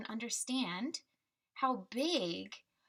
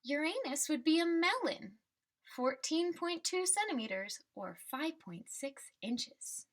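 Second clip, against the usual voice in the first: put-on reading to children; 3 semitones higher than usual. Teacher reading the class a picture book, storybook voice. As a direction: read the refrain like a picture book for kids.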